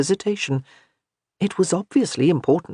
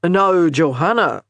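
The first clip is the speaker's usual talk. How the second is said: slow, louder, warning tone; 5 dB louder, slower than usual. A narrator's reading. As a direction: caution her, slowly. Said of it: "a warning in his voice".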